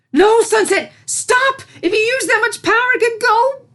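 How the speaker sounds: very high, loud cry of alarm; alarmed shout. Acted. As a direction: yell to stop her before it's too late. Princess shouts to stop the spell.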